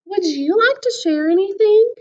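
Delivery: lightly voiced teacher asking; a kindly teacher inviting; teacher's question. A narrator's reading. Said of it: "she asked".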